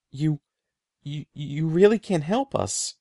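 desperate stammering plea; plead, tripping over the words. A narrator's reading. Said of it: "I pleaded".

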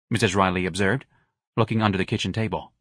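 plain reading voice

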